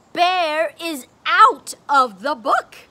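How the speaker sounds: mock surprise. Shown as a demonstration. Teacher to child, hammed-up gasp at the book. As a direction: play it up with a big gasp. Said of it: "Watch this".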